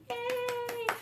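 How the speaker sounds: high-pitched, light yay; happy cheer. Real, not acted. Cheer after finishing the song.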